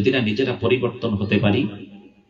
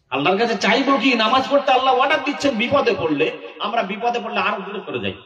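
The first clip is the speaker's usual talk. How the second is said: high, pressing sermon delivery; impassioned preaching; 10 semitones higher than usual. Real, not acted. Preacher rebuking worshippers who skip prayer in trouble, impassioned preaching. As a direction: drive it high, then fall away at the end.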